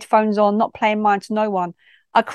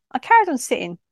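ordinary delivery

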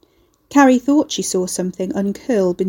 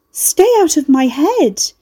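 higher, louder, slow and frantic; frantic shout; 7 semitones higher, 6 dB louder, slower than usual. A narrator's reading. Carrie begging the house to leave her mind, frantic shout. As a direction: shout it with hands over the ears.

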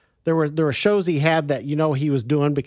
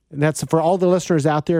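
ordinary talk

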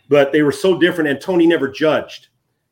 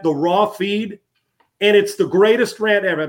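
ordinary talk

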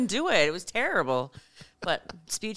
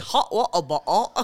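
slow mock British with swallowed consonants; British accent; slower than usual. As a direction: struggle through it in a British accent. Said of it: "he literally couldn't say it".

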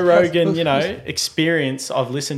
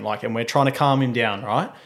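normal talking voice

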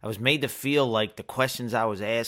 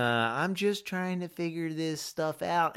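innocent; 5 semitones higher, 3 dB quieter than usual. Retold or imitated. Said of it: "I played on the angle".

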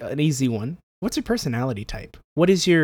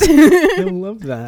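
high, slow laughing delight; 12 semitones higher, 10 dB louder, much slower than usual. Real, not acted. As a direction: say it through a big laugh.

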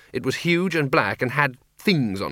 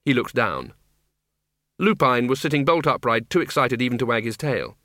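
ordinary delivery, nothing marked

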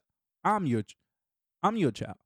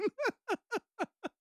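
high wheezy laugh; high laughter; 4 dB quieter than usual. Real, not acted. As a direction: keep laughing at the joke. Laughing at the bit.

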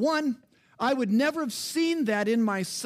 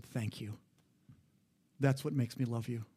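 softly, much softer, a gentle grateful murmur; wife's tender thanks; 8 semitones lower, 7 dB quieter than usual. Retold or imitated. Husband retells wife thanking him in the car, soft and tender. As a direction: hush it like a quiet thank-you from the passenger seat. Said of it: "she just looked at me and said".

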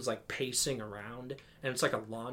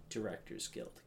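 usual speaking voice

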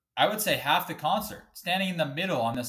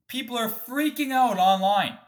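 indignant outburst; 10 semitones higher, 6 dB louder than usual. Real, not acted. Angry rant about hockey fine.

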